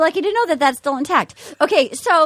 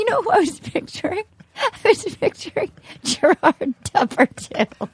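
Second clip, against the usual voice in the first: higher, breaking up with laughter; laughing; 4 semitones higher than usual. Real, not acted. Host cracking up over whom she was picturing, laughing. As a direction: squeeze the words out through laughter.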